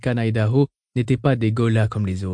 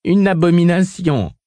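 higher, louder outburst; a sharp, raised exclamation; crying out; 6 semitones higher, 5 dB louder than usual. A narrator's reading. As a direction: exclaim it with force. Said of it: "s'écria Taratsa".

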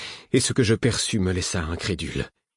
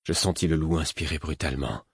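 ordinary delivery, nothing marked

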